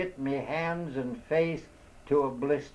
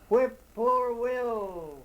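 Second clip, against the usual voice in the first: high, slow drawn-out whip-poor-will cry; distant bird call; 9 semitones higher, much slower than usual. A narrator's reading. A bird calling through the dark woods, high and drawn out. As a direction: call it like a far-off night bird. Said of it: "I heard somebody a long way off".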